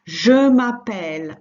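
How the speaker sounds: "Je m'appelle" said loud, with a push on "je"; emphatic model. Shown as a demonstration. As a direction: announce the answer loud and clear. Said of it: "And the answer?".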